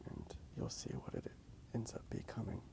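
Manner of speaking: normal talking voice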